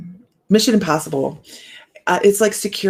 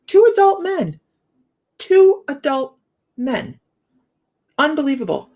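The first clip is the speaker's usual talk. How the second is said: incredulous; 9 semitones higher, 3 dB louder than usual. Real, not acted. Mocking two fighting grown men.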